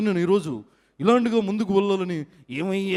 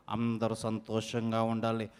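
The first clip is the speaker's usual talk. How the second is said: much lower, much softer blessing; health show host; 10 semitones lower, 10 dB quieter than usual. Acted. Mimic repeats the wish as a hushed low health host. Same line, new voice.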